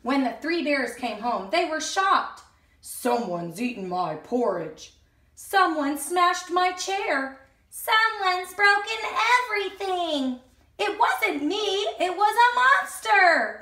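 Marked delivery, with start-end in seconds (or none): 2.8-4.9 low, deep bear voice; Daddy Bear. Acted. Daddy Bear finding his porridge gone, low. 5.3-7.4 slow, higher bear voice; dismayed mother bear. Acted. Mummy Bear discovering her chair, slow and higher. 7.7-10.4 high small bear voice; upset little cub. Acted. Baby Bear seeing everything broken, high. 10.8-13.6 high, louder denial. Acted. Goldilocks denying to the bears, high and loud.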